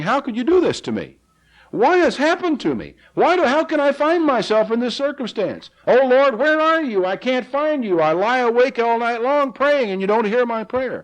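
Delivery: anguished cry to God. Acted. Psalmist crying out to an absent God, anguished and pleading. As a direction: beg God to answer, in anguish. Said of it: "he's crying out to God saying".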